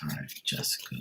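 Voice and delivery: barely voiced, breathy murmur of her name; whispered aside. Real, not acted. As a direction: say it under your breath. Soft aside before reading.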